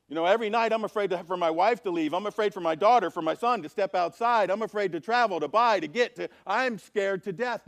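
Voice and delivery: loud, high, pouring out the worries; acted frantic fear. Townsperson telling Jesus of nightly fears, loud and frantic. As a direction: plead loudly like a frightened townsperson. Acting a terrified townsperson's plea.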